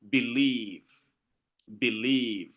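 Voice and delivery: believe with a quick soft be- and a long falling -lieve; stress on second syllable. Shown as a demonstration. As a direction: model believe slowly for a class, weight on -lieve. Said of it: "you don't insist, insist on the B".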